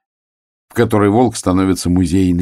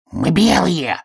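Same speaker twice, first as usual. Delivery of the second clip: muffled; 11 semitones higher, slower than usual. A narrator's reading. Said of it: "глухо сказал заяц".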